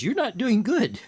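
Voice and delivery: mock reproach, high and emphatic; retold accusation. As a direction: act out someone accusing the listener, pitched well up.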